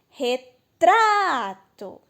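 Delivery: Retrato stretched on a loud, swelling "tra"; slow Retrato with a big "tra"; stress on middle syllable. Shown as a demonstration. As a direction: call out Retrato, holding "tra" long. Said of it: "o tra é a sílaba do meio".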